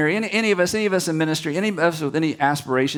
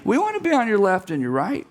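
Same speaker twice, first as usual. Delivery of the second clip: high, slow mock whine of jealous disciples; whiny disciples; 10 semitones higher than usual. Disciples jealously whining to Jesus about their places. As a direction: whine like left-out followers. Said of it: "They're indignant like".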